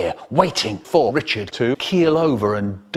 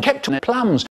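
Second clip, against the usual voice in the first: ordinary delivery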